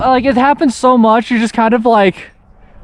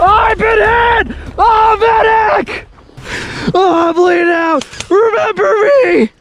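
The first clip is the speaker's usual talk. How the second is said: dying soldier; 9 semitones higher than usual. Acted. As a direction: overact a fatal wound. Playing a mock dying soldier.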